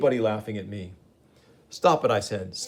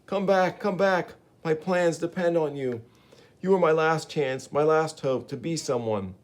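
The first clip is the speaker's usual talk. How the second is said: much higher pleading call; desperate plea; 6 semitones higher than usual. Acted. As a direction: beg him not to leave. Gibbon begs Spider-Man to return.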